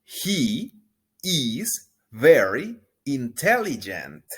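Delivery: slow, clear teacher model; drawn-out model line; slow model sentence. Shown as a demonstration. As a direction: say it slowly to be repeated. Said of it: "Repito una vez más".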